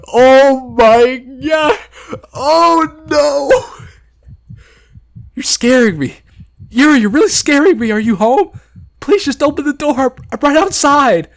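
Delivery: high, loud, slow horrified cry; horrified plea to a friend; panicked cry. Acted. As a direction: wail it in rising horror. Panicked reaction and pleading.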